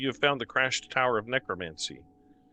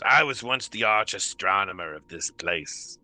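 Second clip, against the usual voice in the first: a hint of a slow old creature; lightly voiced creature; 3 semitones lower, slower than usual. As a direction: speak as a faded, proud astronomer. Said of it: "the creature sort of says".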